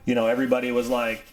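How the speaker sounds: normal talking voice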